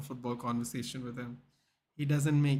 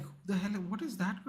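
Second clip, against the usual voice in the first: mock bafflement; 6 semitones higher, 3 dB quieter than usual. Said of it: "I was like".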